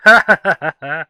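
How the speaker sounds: loud laughing exclamation. Real, not acted. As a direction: burst out laughing. Laughing burst.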